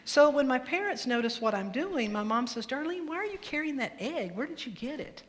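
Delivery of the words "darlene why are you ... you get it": lightly voiced mom, higher pitch; a higher, puzzled parent's question. Mom asks Darlene about the egg, lightly questioning.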